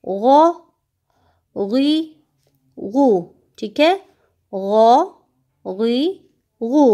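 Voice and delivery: गौ गुई गु said thick with rounded lips; heavy ghain. Shown as a demonstration. Teacher modelling heavy ghain for pupils. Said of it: "इसको हमने क्या करना है? मोटा".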